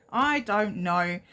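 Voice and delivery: normal talking voice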